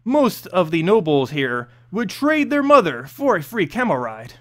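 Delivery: ordinary delivery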